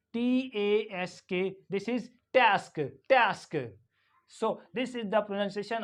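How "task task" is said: TASK as 'taesk', front 'ae' vowel; American A. Shown as a demonstration. Teacher drilling American English, 'ae' in TASK. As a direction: say task with the A of cat. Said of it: "in American English".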